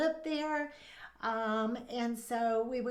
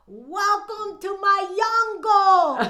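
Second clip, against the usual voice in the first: slow, loud, high menacing welcome with a laugh; bullfighter's taunt; 7 semitones higher, 11 dB louder, slower than usual. Retold or imitated. Man at the fence teasing the blonde visitors, slow loud showman taunt. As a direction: draw out each word like a showman. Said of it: "he goes".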